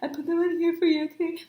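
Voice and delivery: high, awkward little voice; nervously high-pitched, mock awkwardness. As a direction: re-enact a flustered fan. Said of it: "I was so freaking awkward".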